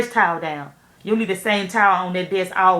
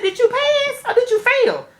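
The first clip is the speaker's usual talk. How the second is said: much higher, slow and careful; high polite question; 3 dB louder, slower than usual. Retold or imitated. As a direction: ask it sweetly, in a high voice. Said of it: "I said".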